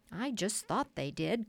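plain reading voice

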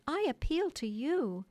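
Wendy appealing to Toodles; pleading appeal. A narrator's reading. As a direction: beg him for help. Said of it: "she cried".

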